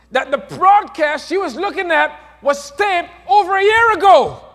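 rising sermon climax; full-force preaching. Acted. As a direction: preach it to the rafters.

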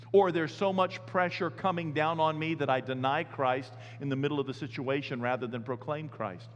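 ordinary talk, nothing marked

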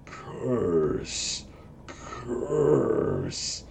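soft, drawn-out rasping growl; muttering ogre wight. Acted. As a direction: mutter it slowly, like an undead ogre. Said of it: "it just muttering the phrase".

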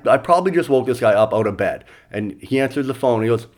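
ordinary delivery

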